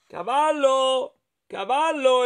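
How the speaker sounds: high, drawn-out searching call. Acted. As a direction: call for someone lost in the fog. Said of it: "esclamò Riccio". (richiamo acuto e prolungato)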